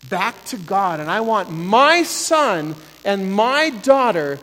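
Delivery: builds to a loud, pleading shout; impassioned yell. Real, not acted. Preacher pleading for the children, yelling in full fervour. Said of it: "Sorry, I didn't mean to yell at you".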